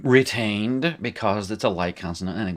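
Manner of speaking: ordinary talk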